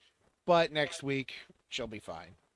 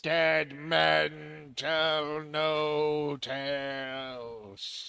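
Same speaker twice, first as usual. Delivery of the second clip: very slow ominous pirate line; much slower than usual. Pretend. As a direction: drag it out like a ghostly captain. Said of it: "Talk like a pirate day".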